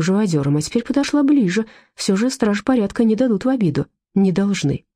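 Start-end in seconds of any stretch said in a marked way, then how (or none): none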